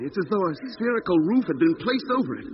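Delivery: high, strained disbelief; worked-up, pleading; agitated insistence. Acted. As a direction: insist urgently he can see it. Doc insists he sees the dome.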